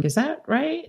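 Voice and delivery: doubtful question, lifted high and soft on "right". Retold or imitated. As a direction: imitate someone unsure, "right" rising softly as a question. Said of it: "He said it like a question".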